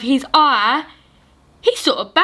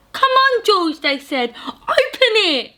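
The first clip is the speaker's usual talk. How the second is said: eager animals; 9 semitones higher than usual. Acted. Animals urge George.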